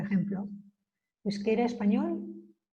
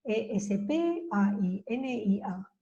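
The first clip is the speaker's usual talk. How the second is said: slow letter spelling; 3 dB quieter, slower than usual. Shown as a demonstration. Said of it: "se pone la I delante de la N".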